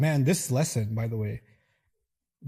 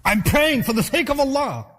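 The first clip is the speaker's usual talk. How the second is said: louder, higher, defiant; indignant, raised "for the sake of Allah"; getting angry; 9 semitones higher, 5 dB louder than usual. Shown as a demonstration. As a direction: snap back in offended anger. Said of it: "now you're getting angry".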